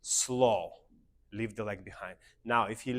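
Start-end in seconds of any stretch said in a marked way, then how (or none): 0.0-0.7 long, stretched SLOW, falling at the end; drawn-out dance count. Shown as a demonstration. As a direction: draw out the word slow for the step. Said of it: "We say slow".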